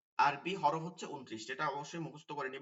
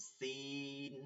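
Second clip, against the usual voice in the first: "সিন" with a long ee, softer and held; stretched vowel; 2 semitones lower, 10 dB quieter, much slower than usual. Shown as a demonstration. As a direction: draw out the middle of "সিন". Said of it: "একটু টান দিয়ে হবে". (নিচু স্বরে টান দিয়ে "সিন")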